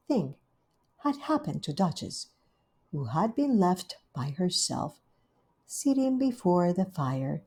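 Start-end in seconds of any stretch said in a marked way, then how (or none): none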